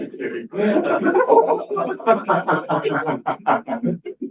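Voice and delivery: high, drawn-out laughter; long real laugh. Real, not acted. Cracking up at his own divorce joke in front of the audience.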